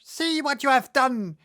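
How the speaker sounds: high, loud witch scream; melting witch screams at Dorothy. A narrator's reading. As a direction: shriek it in panic. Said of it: "she screamed".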